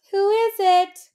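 high, loud pigs calling. Acted. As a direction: call out high like little pigs. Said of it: "called the pigs".

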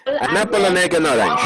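fast, laughing run through the list; roadrunner speed. Shown as a demonstration. Teacher races through the words for the class, laughing. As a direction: rattle it off as fast as you can, laughing. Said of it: "velocidad corre caminos".

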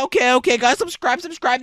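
ordinary talk, nothing marked

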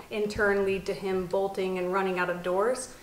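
ordinary talk

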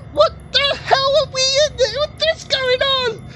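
high, panicked disbelief as horror appears; frightened high cry. Real, not acted. Gamer spooked by bodies in game, high frightened cry. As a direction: voice jumps way up in fright.

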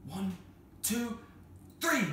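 high-pitched count-in; counting shout. Acted. Children on the tower count again to yell, called out high.

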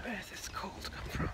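breathy whisper against the cold. Real, not acted. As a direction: hush the words in chilly air.

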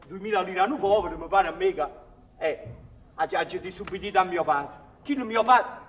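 usual speaking voice

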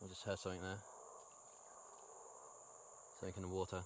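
quiet, careful whisper-like voice; hushed voice. Real, not acted. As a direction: keep it hushed so nothing hears. Hushed, hearing something in the dark.

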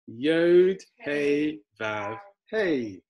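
drawn-out letter names, starting loud; say-along recitation. Shown as a demonstration. Teacher leads learners saying it together, slow recitation. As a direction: lead a group through each word slowly. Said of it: "Should we say it together?".

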